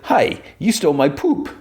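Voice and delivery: high-pitched mock character voice; Mister Charles. A villain calls about a theft, high joke voice. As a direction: play him silly and high. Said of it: "He didn't say it like that".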